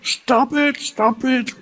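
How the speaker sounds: high-pitched yell without much volume; imitated reactive parent's shout; mock parental shouting. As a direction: act out a parent losing it. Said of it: "then react and start shouting".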